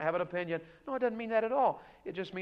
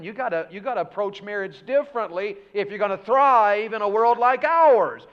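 rising, louder preacher climb; preaching at full force; 3 semitones higher, 9 dB louder than usual. Acted. Pastor urging the congregation, preaching loud. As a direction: build it up from the pulpit.

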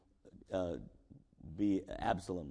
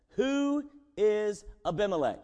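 scornful challenge; 9 semitones higher, 5 dB louder than usual. Retold or imitated.

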